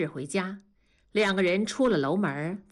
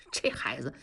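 soft, lower chuckling words; laughing softly; 6 semitones lower, 6 dB quieter than usual. Acted. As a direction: laugh it off under the breath. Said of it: "然后就笑". (轻声笑着说)

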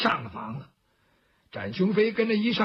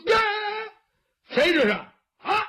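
startled shout; 11 semitones higher, faster than usual. Acted. Zhao Hu calls out as his bowl shatters, startled shout. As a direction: yell out at an unseen intruder. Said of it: "赵虎喊了一嗓子".

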